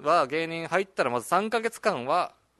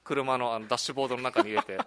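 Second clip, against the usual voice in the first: usual speaking voice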